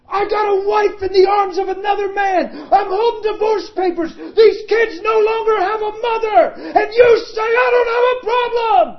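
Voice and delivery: high, loud, crying shout; vehement shouting. Retold or imitated. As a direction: wail out every grievance at full force. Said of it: "He's vehement".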